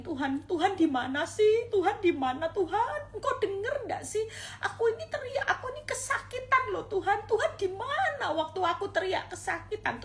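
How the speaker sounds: high, desperate plea with a half laugh, half sob; pained, tearful complaint to God; pleading cry. Acted. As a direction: beg God to answer, voice high and strained. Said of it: "pernah bayangin".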